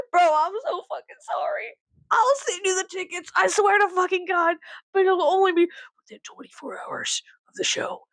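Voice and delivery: high-pitched, pleading retold apology. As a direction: apologize in a high, over-earnest voice. Acts out pleading apology email.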